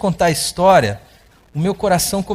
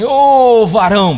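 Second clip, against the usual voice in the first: loud, high hail of "Ô, varão"; gruff call; 4 semitones higher, 8 dB louder, much slower than usual. Retold or imitated. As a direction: bark it out like an annoyed man. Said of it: "o cara é bravo mesmo".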